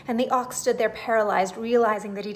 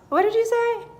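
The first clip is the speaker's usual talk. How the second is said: high, loud, bewildered question; puzzled dodo; 12 semitones higher, 7 dB louder than usual. A narrator's reading. A befuddled dodo asks the auk again, high and lost. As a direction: ask like a bird who already forgot. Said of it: "it looked puzzled and said".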